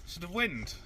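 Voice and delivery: far off. Real, not acted. Partner's mic far, quiet.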